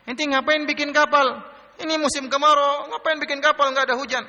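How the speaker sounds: high-pitched jeering heckler; acted-out ridicule, pitched well up; imitated mocking. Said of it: "Ada lagi ngejek".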